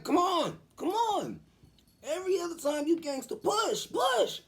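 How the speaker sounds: high, loud goading; hammed-up dad shouting encouragement; retold urgent shouting. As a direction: yell her through the pushing. Father yelling her on in labor.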